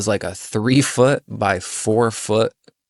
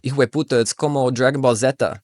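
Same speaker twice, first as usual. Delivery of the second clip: a hint of a raised Spanish voice; Spanish exclamation; 4 semitones higher, faster than usual. Friend comparing the bent arm to a cartoon, in Spanish. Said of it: "my friend kept saying".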